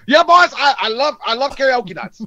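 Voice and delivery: loud, high rowdy bar voice; drunk bar guy. Retold or imitated. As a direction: whoop it up like karaoke night. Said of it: "You're like".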